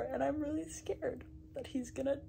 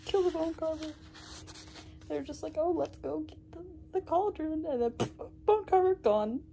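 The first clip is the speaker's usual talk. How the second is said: outraged disbelief; 5 semitones higher, 7 dB louder than usual. Real, not acted. Outraged recap of the death.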